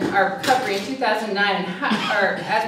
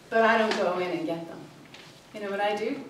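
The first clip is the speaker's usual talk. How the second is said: usual speaking voice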